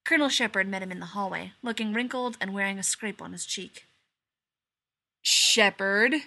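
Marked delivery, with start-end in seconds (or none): 5.2-6.1 growled. A narrator's reading. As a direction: snarl his name at him. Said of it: "Gibbs growled at him".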